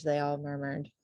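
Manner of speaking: usual speaking voice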